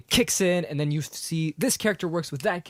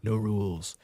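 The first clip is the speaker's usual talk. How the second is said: lightly voiced bad guy; a hint of movie villain; Darth Vader; 3 semitones lower, 3 dB quieter, slower than usual. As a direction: attempt a villain's voice, half-successfully. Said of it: "I wish I had James, uh, James".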